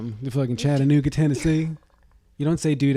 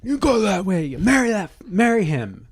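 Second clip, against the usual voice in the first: high, louder mock order shoving the bride along; bossy pushing voice; 9 semitones higher, 3 dB louder than usual. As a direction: push her down the aisle, bossy and high. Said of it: "the bride is kinda trained to be".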